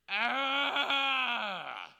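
loud pained cry at the price; pained shout. Acted. Preacher groaning at a gas pump price, loud pained cry. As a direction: let out a hurt yell.

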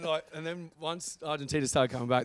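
ordinary delivery

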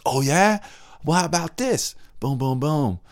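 high-pitched, combative playground retort; mock angry comeback. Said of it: "I'm gonna speak louder than you".

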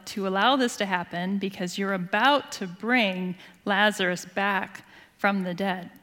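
ordinary delivery

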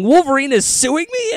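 high, incredulous mock question; acted disbelief. Retelling a reaction to being sued, incredulous.

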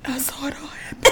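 breathless whisper, almost no voice; hushed witness. Pretend. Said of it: "I should be like".

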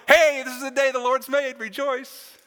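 high, loud, over-eager pep; mock cheerful exhortation. Chirping a verse at someone rained out of golf, mock cheerful. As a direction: belt it out as hammed-up cheer.

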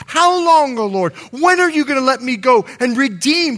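high, urgent appeal; crying out. Acted. Jesus crying out to the Lord, urgent plea. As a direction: plead at preaching pitch. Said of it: "he cries out".

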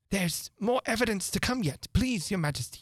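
high, anxious-to-please courtier. A narrator's reading. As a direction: pipe up urgently to the king. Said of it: "jumping up in a great hurry".

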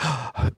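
sharp, acted gasp of fright; scared gasp. Dreamer chased by goblins, imitated scared gasp. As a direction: mimic a frightened intake of breath. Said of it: "like, so scared and running".

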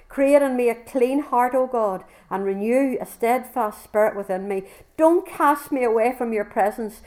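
reading the psalm aloud to the congregation with passion; impassioned scripture reading. Acted. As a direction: pour out the psalm as a plea to God. Reads Psalm fifty-one aloud.

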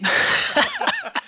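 very high squealing laugh; falsetto laugh. Real, not acted. Laughing at Russian accent joke.

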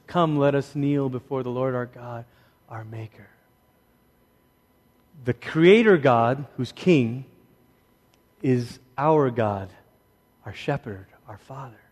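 reverent reading. Acted. Preacher reads the psalm to the congregation, soft and reverent. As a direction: let the verse sink in, hushed.

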